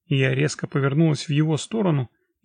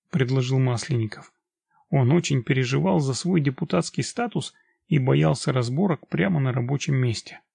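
ordinary delivery, nothing marked

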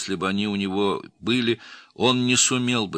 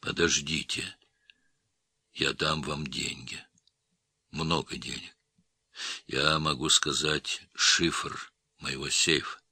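soft, low, halting bargaining; whispered plea; 4 semitones lower, 5 dB quieter than usual. A narrator's reading. Wounded man offers his killers money, soft halting near whisper. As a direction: plead faintly, with long breaks between phrases. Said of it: "прошептал Махмудбеков".

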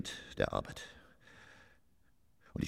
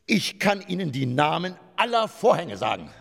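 loud boast; 8 dB louder than usual. Acted. Boasting loudly.

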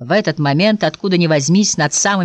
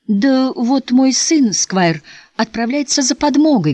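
higher, proudly announced; a touch of motherly pride, pitched up; 8 semitones higher, slower than usual. A narrator's reading. Mother tells the Squire about her son, proud. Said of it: "гордо ответила миссис Барлилав".

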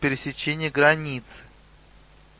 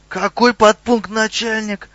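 high-pitched, startled question; scared troll questioning authority; frightened; 6 semitones higher, 3 dB louder than usual. A narrator's reading. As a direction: blurt it out in alarm. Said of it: "испуганно спросил Хрюгл".

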